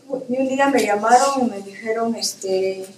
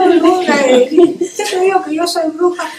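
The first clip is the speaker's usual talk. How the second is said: laughing retort; 5 semitones higher, 5 dB louder than usual. Retold or imitated. Retelling her own reply, laughing.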